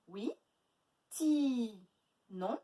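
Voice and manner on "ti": TI with a long held i; stretched syllable. Shown as a demonstration. Teacher checking the middle syllable for A, stretched. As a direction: stretch Ti into tiii. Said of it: "Où est-ce qu'on entend A?".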